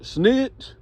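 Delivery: high-pitched jeering call; imitated yelling out. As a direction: yell it like an accusation across the room. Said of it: "Larry yelled out".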